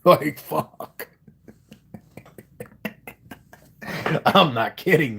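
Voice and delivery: laughing through words. Real, not acted. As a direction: talk while cracking up. Laughs while talking.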